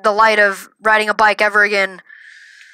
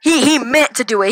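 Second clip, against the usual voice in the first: plain reading voice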